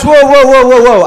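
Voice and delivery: high, loud startled whoa; alarmed whoa. Retold or imitated. Neighbor recoiling from the cursing man, loud alarmed whoa. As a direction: back off fast, alarmed.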